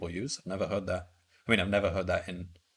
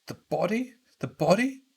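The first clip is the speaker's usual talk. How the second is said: high-pitched, offended disbelief; indignant outburst; 7 semitones higher than usual. Acted. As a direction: protest on behalf of a dead friend. Outraged at calling friend body.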